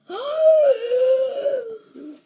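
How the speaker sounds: long, high, loud mock gasp; dramatic gasp. Storyteller leaving listeners hanging at the laboratory, dramatic gasp. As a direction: draw out a big high gasp for the cliffhanger.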